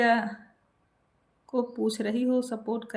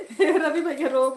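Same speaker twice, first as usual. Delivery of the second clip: laughing tease; 4 semitones higher, 4 dB louder than usual. Real, not acted. Laughing while teasing Ravi.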